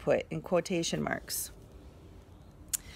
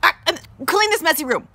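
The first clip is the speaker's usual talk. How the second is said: high, loud hesitant cut-in; mother interrupting loudly; interrupted; 10 semitones higher, 10 dB louder, faster than usual. Shown as a demonstration. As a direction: break in with an um, then blurt it. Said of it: "interrupted Mother".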